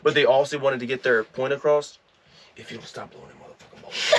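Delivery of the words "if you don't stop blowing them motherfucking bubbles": hushed angry whisper-shout; whisper yelling. Shown as a demonstration. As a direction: scold through a whisper, like a parent in a store. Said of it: "You gotta whisper yell it".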